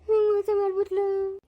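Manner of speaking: hammed-up sobbing lament; mock crying wail. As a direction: wail it out like a jilted husband, overdone. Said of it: "ಅಯ್ಯೋ ಅಳ್ಬೇಡ ಹೋಗಪ್ಪ".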